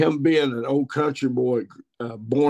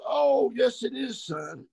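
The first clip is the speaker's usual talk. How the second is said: dad reassuring; 11 semitones higher, slower than usual. Retold or imitated. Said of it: "he goes".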